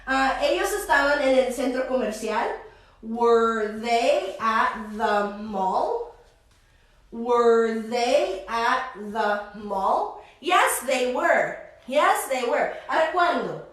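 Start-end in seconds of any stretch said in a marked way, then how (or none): 3.0-10.2 slow, clear question with rising end; slow teaching read. Shown as a demonstration. Teacher models the question slowly for the class, slow rising. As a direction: spell it out slowly for students.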